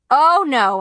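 high, loud refusal; shouting. A narrator's reading. Girl shouts her refusal to make the call. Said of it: "I shouted".

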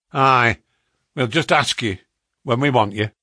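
loud Northern English woman's rebuff; northern accent. A narrator's reading. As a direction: snap it back loudly in a broad northern accent. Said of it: "she asked in a northern accent".

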